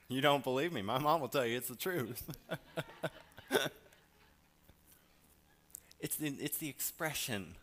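normal talking voice

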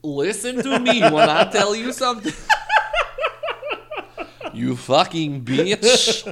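loud, laughing fake scolding; laughing mock scold. Real, not acted. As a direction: scold her while cracking up.

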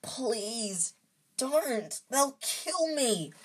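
high, desperate begging; drawn-out, high pleading; croaked plea. A narrator's reading. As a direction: plead as if terrified of the consequences. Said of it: "croaked Harry".

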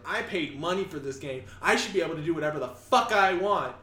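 entitled gamer. Retold or imitated.

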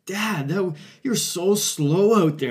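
son chirping. Retold or imitated. Teen son chirping his dad on the bench, mock taunting. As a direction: tease Dad like a cocky thirteen-year-old. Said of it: "And I remember him saying".

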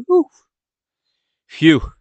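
two puffed sighs of relief, spaced apart; relieved phew. Retold or imitated. Fan sitting back after the win, relieved phew. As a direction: blow out a re-enacted sigh of relief.

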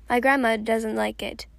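plain reading voice

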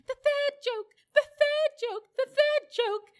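very high, loud squawky bird voice; greatly excited jackdaw. A narrator's reading. Jackdaw announces a joke to the animals, greatly excited. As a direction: cry it out in high-pitched excitement. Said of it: "said the jackdaw in great excitement".

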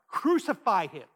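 loud, forceful imitation of a mob; retold crowd shout. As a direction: call it out like a crowd demanding punishment. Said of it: "They're gonna shout".

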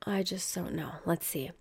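breathy whispered aside to herself. Real, not acted. Reading the news on stream, whispering to herself. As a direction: whisper it, almost to yourself. Muttering to self, unvoiced.

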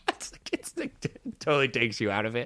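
usual speaking voice

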